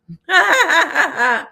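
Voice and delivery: high, loud burst of laughter; big laugh. Real, not acted. Recalling mom's morbid snow joke, laughing hard.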